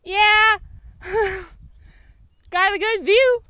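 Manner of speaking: high, loud laughing shout; shouting down the slope between laughs; laughing call. Real, not acted. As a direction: yell down with a laugh. Still calling down, laughing.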